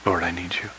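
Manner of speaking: low, very soft prayer; an understated cry to God, almost private; hushed plea. Acted. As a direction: pray it quietly and low. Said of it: "that's one of my cries".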